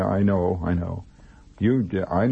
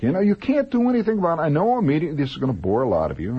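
excited rush; 7 semitones higher, faster than usual. Real, not acted. Excited fan rant.

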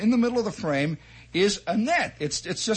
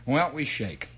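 ordinary delivery

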